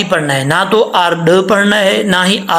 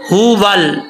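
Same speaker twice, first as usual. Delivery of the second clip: ordinary delivery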